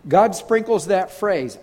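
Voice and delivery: usual speaking voice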